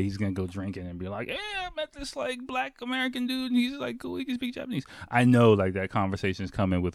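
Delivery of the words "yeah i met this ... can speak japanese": high, soft falsetto brag; squeaky mock excitement, held throughout; day-made stranger. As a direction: mimic a thrilled man telling drinking buddies. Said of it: "I just made his day".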